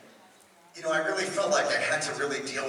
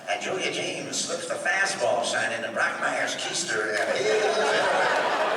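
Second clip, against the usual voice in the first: Brockmire announcer call; slower than usual. Acted. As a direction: call it like a home run on the radio. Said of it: "we even put that in Brockmire".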